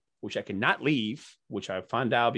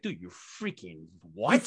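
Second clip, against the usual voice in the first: slow, lower disbelief; disbelieving put-down; 8 semitones lower, 3 dB quieter, slower than usual. Retold or imitated. Guest retelling his jab at the pancake artist, slow and incredulous. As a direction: drag it out in disbelief. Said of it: "I was like".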